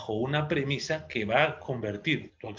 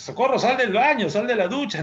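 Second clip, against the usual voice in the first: loud, high-pitched acted scolding yell; comic nagging mother's shout; mother calling daughter; 10 semitones higher, 7 dB louder than usual. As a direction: order the daughter out at the top of your voice. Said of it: "era la mamá que decía a la hija".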